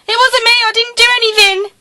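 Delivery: high, loud wailing protest of innocence; screamed, high-pitched denial; desperate plea. Acted. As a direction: cry it out shrilly, pleading with the constable. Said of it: "despite her pleas".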